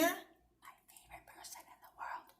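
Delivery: soft whispered side comment; whispered aside. Real, not acted. Reader whispers to viewers about a favourite person. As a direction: breathe it as a secret aside. Aside while reading aloud.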